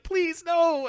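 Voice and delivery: high, loud mock plea; pleading. Third captive begging to be spared, mock pleading.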